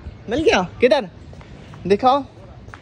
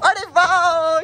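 excited cheer; 7 semitones higher, 6 dB louder, much slower than usual. Real, not acted. Ball comes to him.

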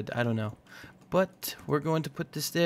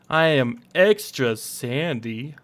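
slow, drawn-out cartoon quote; lightly put-on cartoon voice, stretching the words; SpongeBob quote; 3 dB louder, slower than usual. Gamer quoting a cartoon while needing sandy glass, slow put-on voice. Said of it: "did I just quote SpongeBob".

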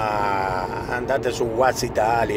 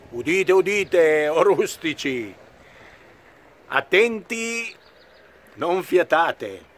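slow grand proclamation; town crier; 6 semitones higher, slower than usual. Acted. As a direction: call it out to the crowd. Declaims opera opening. (come un banditore, solenne e lento)

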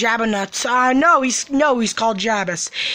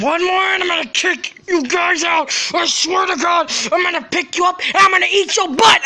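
mad threat; 4 semitones higher than usual. Acted. Big toy threatens to kick the visitors out, shouting furiously. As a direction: yell it like a toy losing its temper. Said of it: "I just get mad sometimes".

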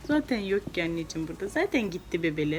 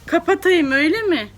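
higher and louder, playful mock scold; 8 semitones higher, 7 dB louder than usual. Mother pretending to be shocked her daughter wants it off.

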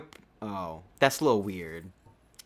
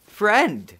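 eager hail; 8 semitones higher, 7 dB louder than usual. Real, not acted. Greets NPC.

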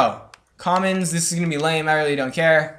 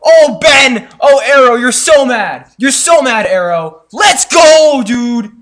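gloating; 7 semitones higher, 10 dB louder, slower than usual. Real, not acted. Gloating at friend Arrow.

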